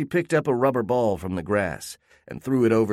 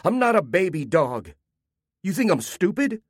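put-on offended boy; 9 semitones higher than usual. Benji voiced, offended.

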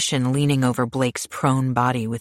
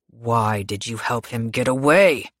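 menacing man; 2 semitones lower than usual. Acted. Pierce interrogates her.

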